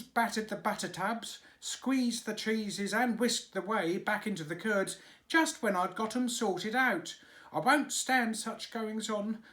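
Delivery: high, indignant dairymaid voice. A narrator's reading. Dairymaid complaining to the nurse, high and indignant.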